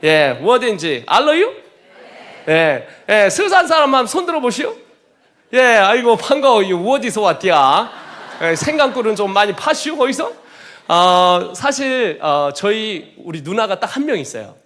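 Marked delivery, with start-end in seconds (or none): 0.0-10.3 loud, high-spirited Chungcheong country talk; Chungcheong dialect. Pretend. Preacher joking with Seosan congregants in a loud put-on Chungcheong dialect. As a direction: chat like a jolly Seosan local. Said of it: "충청남도에서".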